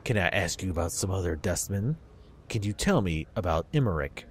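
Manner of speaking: normal talking voice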